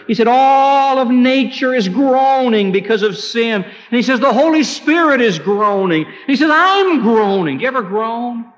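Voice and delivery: high, forceful pulpit cry; fervent preacher in full flight; impassioned preaching. Real, not acted. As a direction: proclaim it high and loud, drawing out key words.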